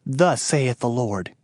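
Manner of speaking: ordinary talk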